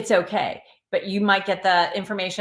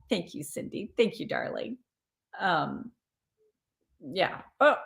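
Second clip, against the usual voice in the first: normal talking voice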